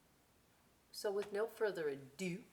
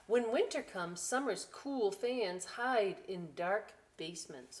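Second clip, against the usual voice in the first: story reading; 2 semitones higher, 4 dB louder than usual. A narrator's reading. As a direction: savour each line for the kids. Reading book.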